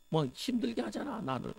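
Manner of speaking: high, soft mock crying voice; imitated tearful whine. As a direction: imitate a spirit whining in complaint. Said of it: "막 울고 막 짜증내요".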